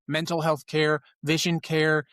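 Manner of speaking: ordinary talk, nothing marked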